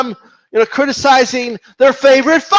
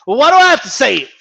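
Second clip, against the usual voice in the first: ordinary delivery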